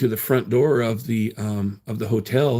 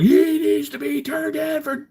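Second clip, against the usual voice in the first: much higher, slow mock-outraged tattletale; mocking critic voice. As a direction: mimic the scolds on X.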